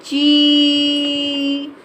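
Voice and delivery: ची held long with a stretched ee; stretched long vowel; long drawn ee. Shown as a demonstration. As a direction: draw out the ee in ची for the class. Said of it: "इसको देर तक बोलेंगे".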